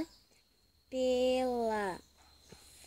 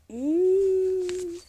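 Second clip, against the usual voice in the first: high, loud happy mmm; loud yummy hum; 7 semitones higher, 10 dB louder than usual. Acted. Eating strawberry sound.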